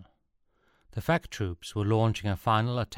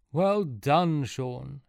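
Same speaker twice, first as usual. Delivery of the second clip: exclaimed praise; 5 semitones higher, much slower than usual. A narrator's reading. Luca congratulating Sean on getting the egg, exclaiming. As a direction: exclaim it, pitched up and pleased. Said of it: "exclaimed Luca".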